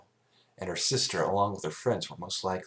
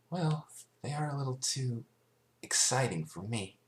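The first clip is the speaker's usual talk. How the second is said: timid sweet Fluttershy; 7 semitones higher than usual. Acted. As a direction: speak gently and bashfully.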